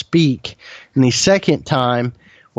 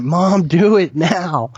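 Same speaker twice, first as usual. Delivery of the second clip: slow, firm mock yell; mild retold yell; 3 semitones higher, slower than usual. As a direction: spell out each word firmly. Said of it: "I yelled at my mom".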